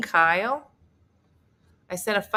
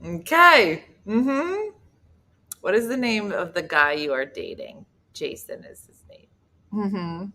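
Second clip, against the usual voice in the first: ordinary talk, nothing marked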